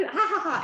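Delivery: high imitated laugh, "ha ha ha"; re-enacted high laughter; retold laughing. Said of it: "And so I start laughing".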